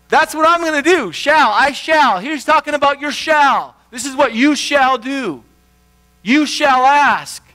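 loud, high preaching, slowing on "You shall ask"; full-force preaching. Acted. Pastor to congregation on God's promise, preaching full force.

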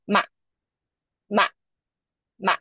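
MA short, without a big fall; neutral tone. Shown as a demonstration. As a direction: say MA quickly in neutral tone. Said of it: "the neutral tone is".

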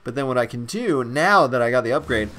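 normal talking voice

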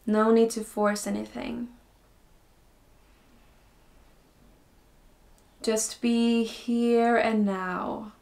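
slow, calm meditation voice with long pauses; guided meditation. Acted. Teacher guiding beginners into breathing and stillness, slow guided meditation. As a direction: ease them in slowly, softening as phrases end.

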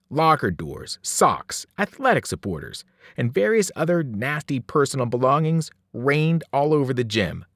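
ordinary delivery, nothing marked

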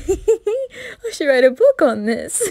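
slow laughing gush; giddy laughter. Acted. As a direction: cackle with delight.

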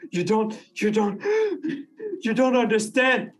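high-pitched, gasping panic, stuttering the words; panicking. Acted. Player character panicking at a gentleman, high and frantic. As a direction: play breathless rising panic, breaking between phrases. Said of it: "I'm just gonna really start panicking".

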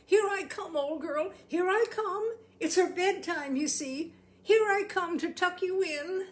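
a doting owner calling across the caravan. A narrator's reading. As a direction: sing out reassuringly to the pig. Fred calls out to squealing pig.

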